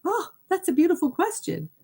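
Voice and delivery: high, surprised little gasp of delight; light up at the question; delighted surprise. Retold or imitated. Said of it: "So I was like".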